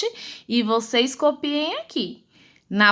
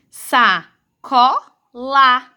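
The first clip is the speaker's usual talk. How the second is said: slow syllables, last one repeated; sílabas separadas; 4 dB louder, much slower than usual. Shown as a demonstration. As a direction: sound out Sacola as SA-CO-LA, then LA. Teacher sounds out, repeats last syllable. (leitura silabada e lenta)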